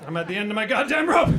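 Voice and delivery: loud outburst at wit's end; stressed out. Acted. Overworked comedian vents to audience, stressed-out yell. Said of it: "I'm a stressed out individual".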